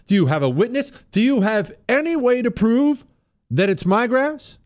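high, rising insistent questioning; challenging. Retold or imitated. Homeowner demanding proof over the phone, high insistent challenge.

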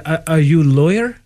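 put-on foreign accent, rising question; lightly imitated immigrant accent; broken-English accent. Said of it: "hearing street jokes in broken English".

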